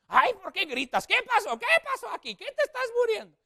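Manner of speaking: put-on shrill high shouting old grandma; impatient grandmother. Grandma scolding the screaming sister, high and loud. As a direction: screech like an impatient abuela. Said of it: "que no era la abuela más paciente del mundo".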